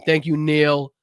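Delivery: normal talking voice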